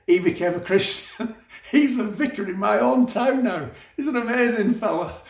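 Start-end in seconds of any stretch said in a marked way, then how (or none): 1.5-5.2 amused, higher-pitched delight; chuckling with delight; laughing. Real, not acted. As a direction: talk through a laugh, pitched up. Laughs while telling conversion story.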